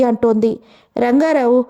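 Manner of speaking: plain reading voice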